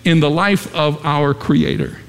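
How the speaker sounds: high-pitched, emphatic pulpit delivery; fervent preaching. Acted. Preacher telling congregation of God's investment, fervent preaching.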